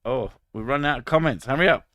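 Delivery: ordinary talk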